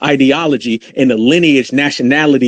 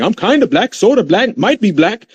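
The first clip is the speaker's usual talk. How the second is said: higher-pitched imitation of people hedging; mock their wishy-washy claims in a raised voice; mock hedging claimants; 6 semitones higher than usual. Mimicking people who come up with everything, mock hedging. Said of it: "They gonna come up with everything".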